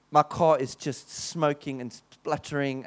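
ordinary talk, nothing marked

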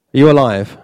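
normal talking voice

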